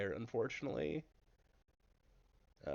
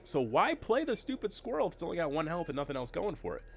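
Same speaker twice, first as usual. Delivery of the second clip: higher-pitched voice of a puzzled viewer; imitated audience question, raised pitch; mock viewer question; 8 semitones higher than usual. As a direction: ask it as a doubting viewer, pitched up. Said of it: "But now you're asking".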